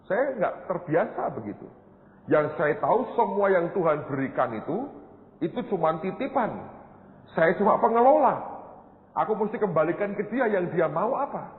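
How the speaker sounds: high, impassioned preacher voice; fervent preaching. Acted. Pastor testifying to his church, fervent high preaching. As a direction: drive the point home to the congregation.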